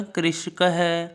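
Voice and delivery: कृषक है spoken very slowly; slow, clear कृषक है; slow model reading. Shown as a demonstration. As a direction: draw out कृषक है for pupils to repeat. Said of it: "करिए आप साथ में उच्चारण".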